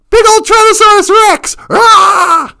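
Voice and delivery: loud, high alarmed exclamation; mock alarm. The teller announces the T. rex to his listeners, loud mock alarm. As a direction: announce the monster in playful panic. Dinosaur appears, mock terror.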